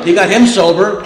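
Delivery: usual speaking voice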